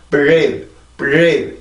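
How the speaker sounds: "breathe" with R made without pushing the lips forward; plain "bree" onset in "breathe", no w-like glide; unrounded R. Shown as a demonstration. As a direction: say "breathe" skipping the lip rounding. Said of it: "음절의 시작이 아니면".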